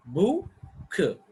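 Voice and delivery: "bu-k" broken into two separate beats; split into syllables. Shown as a demonstration. Teacher showing learners two syllables, "bu-k" split apart. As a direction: draw out "bu", pause, then release "k". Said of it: "two syllables".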